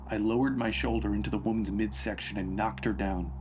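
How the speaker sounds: usual speaking voice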